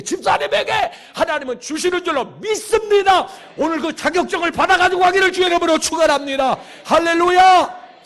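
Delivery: high, loud, driving revival blessing; fervent proclamation to the congregation; full-force preaching. Real, not acted.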